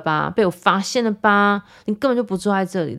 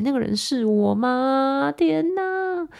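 mock horror; 8 semitones higher, much slower than usual. Real, not acted. Incredulous at her past self.

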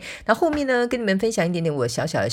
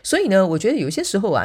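ordinary talk, nothing marked

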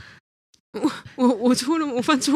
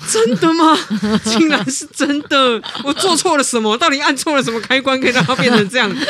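higher and louder, disbelieving; mock astonished girlfriend; 6 semitones higher, 6 dB louder than usual. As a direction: play the shocked girlfriend, half laughing. Girlfriend stunned by the revelation.

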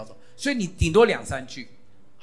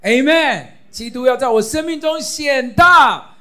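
ringing loud affirmation; loud proclamation; 8 semitones higher, 10 dB louder than usual. Shown as a demonstration. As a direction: declare it high and loud. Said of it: "重读重祷就是大声的来宣告".

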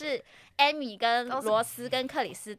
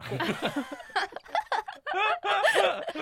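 long laugh; 9 semitones higher than usual. Real, not acted. Laughing about nearly being sued.

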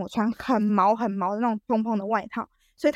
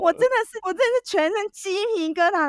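high disgust; 10 semitones higher than usual. Real, not acted. Teller recalling disgust.

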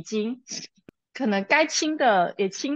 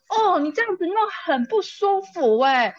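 put-on whiny child voice; 8 semitones higher, 5 dB louder than usual. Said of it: "然后等一下就说".

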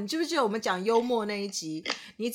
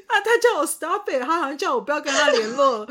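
high, loud laughing retelling; half laughing, half wailing the complaint; a mock hurt whine through laughter; laughing while complaining; 4 semitones higher, 6 dB louder than usual.

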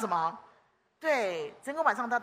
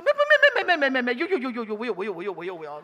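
flustered stammer; 6 dB louder, faster than usual. Retold or imitated. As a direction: stumble over the denial in a panic. Wenliang stammering denial. (模仿文亮结结巴巴急着否认)

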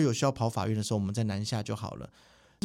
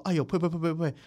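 mock scolding spit; 6 semitones higher than usual. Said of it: "所有人都大发雷".

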